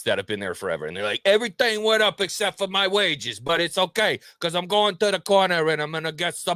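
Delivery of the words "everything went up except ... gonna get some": higher, put-on New Yorker grumble; mock New York accent. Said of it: "hardcore New Yorkers".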